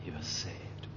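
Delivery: he was saved, whispered in awe; whispered wonder. Real, not acted. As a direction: breathe it as a marvel. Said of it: "Believe it?".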